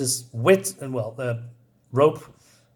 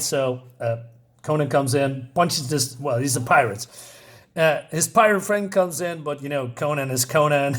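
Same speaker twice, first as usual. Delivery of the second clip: normal talking voice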